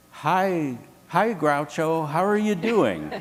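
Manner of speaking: eager nervous greeting. Retold or imitated. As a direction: greet your hero a bit too eagerly. Nervous fan greets his idol.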